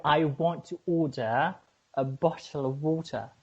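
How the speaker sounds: deliberate British accent, pausing before "a bottle". Shown as a demonstration. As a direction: model British pronunciation in two measured phrases. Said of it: "It's British".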